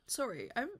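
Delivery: usual speaking voice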